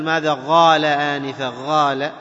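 "غال" with gh replacing the q; qaf like ghayn. Shown as a demonstration. Teacher shows what not to say, gh in "غال". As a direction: gargle the first sound of "غال" as gh. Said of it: "شبيه بالغين".

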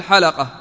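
"حلقة" as ha-la-qa, a short a after the l; "حلقة" in three short syllables, ha-la-qa; lam with fatha. Shown as a demonstration. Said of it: "بفتح اللام".

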